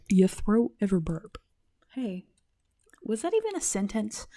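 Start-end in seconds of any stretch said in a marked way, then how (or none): none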